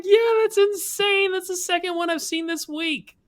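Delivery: very high falsetto imitation; mimicked cart girl. Cart girl answering an excited golfer, mimicked in falsetto. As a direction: put on a squeaky high girl's voice, drawing out the first words.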